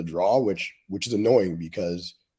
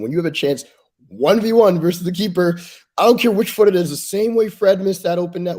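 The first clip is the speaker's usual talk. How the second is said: shouting in frustration, much higher and louder; loud rant; 12 semitones higher, 8 dB louder than usual. Real, not acted. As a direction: let it burst out in exasperation. Ranting about missed chance.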